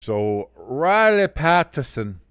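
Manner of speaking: put-on Irish accent. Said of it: "giving him an Irish accent".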